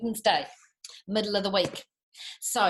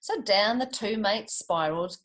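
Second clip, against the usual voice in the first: plain reading voice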